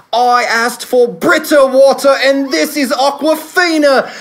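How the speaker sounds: high, loud mock-British tirade; Carrie's British accent. Co-host mimics his partner rejecting the wrong water, shrill British impression. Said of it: "You like my Carrie British accent there?".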